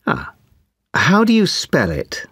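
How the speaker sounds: ordinary talk, nothing marked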